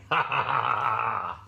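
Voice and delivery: gloating wolf cackle; villainous wolf laugh. Acted. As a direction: cackle like a wolf expecting dinner. Wolf gloats over coming dinner.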